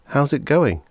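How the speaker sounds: put-on bright kid's question; boy's voice. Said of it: "Tony asked".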